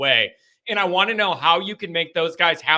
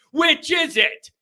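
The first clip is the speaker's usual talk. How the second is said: high-pitched exasperated question; exasperated, voice jumping up; exasperated demand; 10 semitones higher, slower than usual. Real, not acted. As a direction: throw the question up high, fed up. Exasperated at contradictory feedback on overdoing.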